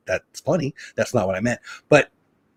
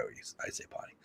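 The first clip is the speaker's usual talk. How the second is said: whispered; 26 dB quieter than usual. Real, not acted. Sheepishly avoiding a bad word.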